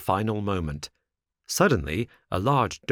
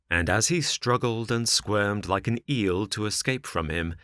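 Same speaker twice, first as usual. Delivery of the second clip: usual speaking voice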